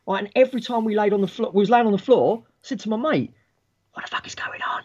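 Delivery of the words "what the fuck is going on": hushed whisper to a classmate; very soft, voiceless aside; secret whisper. Retold or imitated. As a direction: whisper it so teacher won't hear. Pupil asks mate during silent lesson.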